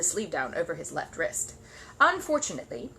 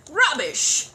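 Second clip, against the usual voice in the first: loud, high spluttering retort; 9 semitones higher, 9 dB louder than usual. Acted. Curry to Gruber, loud spluttering retort. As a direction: splutter it out in outrage. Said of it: "spluttered Mister Curry".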